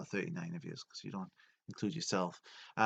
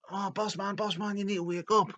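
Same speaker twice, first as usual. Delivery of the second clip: high-pitched urgent call to wake the boss; mock shout, pitched way up; 11 semitones higher than usual. Domo trying to wake up his boss, urgent high shout. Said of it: "he's shouting".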